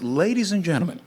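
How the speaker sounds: high, stretched-out emcee build; announcer climax. Real, not acted. Announces company to applause.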